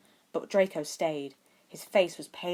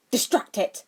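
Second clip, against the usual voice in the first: higher, louder sharp command; snapped order; 5 semitones higher, 8 dB louder than usual. A narrator's reading. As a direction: bark the order in a crisis. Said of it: "Harry snapped at Draco".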